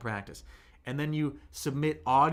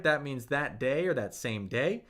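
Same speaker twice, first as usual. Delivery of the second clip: ordinary delivery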